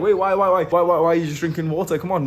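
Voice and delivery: high, repeated mock disbelief; incredulous protest. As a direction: imitate baffled friends pestering. Friends retold protesting his water.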